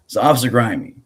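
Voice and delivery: normal talking voice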